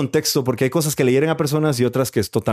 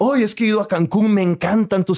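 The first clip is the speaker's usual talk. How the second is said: excited tourist driver; 7 semitones higher than usual. Retold or imitated. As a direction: gush about Mexico. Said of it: "driver retold". (conductor emocionado, voz aguda y lenta)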